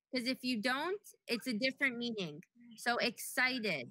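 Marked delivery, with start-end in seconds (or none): 3.0-3.9 excited said slowly, final D clear; excited, slow, landing on the D; slow careful D. Shown as a demonstration. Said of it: "Make sure you pronounce the D".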